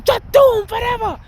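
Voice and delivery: high, eerie witch voice far above his own; witch from Witches. Retold or imitated. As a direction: pitch it way up like a movie witch. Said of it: "I remember that movie, Witches".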